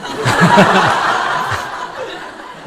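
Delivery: loud burst of open laughter; long hearty laugh. Real, not acted. Laughs at own story.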